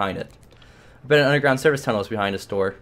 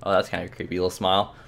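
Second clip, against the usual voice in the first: ordinary talk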